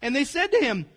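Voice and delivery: ordinary talk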